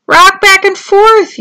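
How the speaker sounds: high, slow, loud yell. A narrator's reading. Turtles below instruct Tudley, yelling. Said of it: "yelled the turtles".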